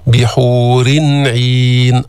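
slow chanted بحور with a clear n before عين; إظهار التنوين. Shown as a demonstration. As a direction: recite بحورٍ عين as bihurin ʿin, the n unmerged. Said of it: "أظهرنا التنوين". (إظهار نون التنوين في بحور قبل عين)

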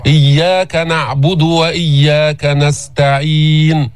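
slow chanted recitation, smooth unbounced س in نستعين; measured Quranic chant with long vowels; still sin. Shown as a demonstration. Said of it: "السين ساكنة".

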